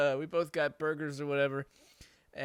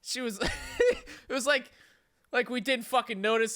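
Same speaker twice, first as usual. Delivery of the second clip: ordinary talk, nothing marked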